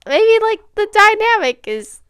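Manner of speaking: high, loud excuse-making voice; mocking past self. Vlogger imitating herself excusing a red flag, high mocking voice. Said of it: "And I was like".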